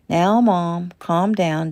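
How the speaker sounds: ordinary delivery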